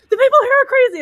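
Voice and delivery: high, loud, panicky whine into the phone; frantic complaint. Retold or imitated. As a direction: wail that everyone here is nuts. Calling parents, desperate complaint.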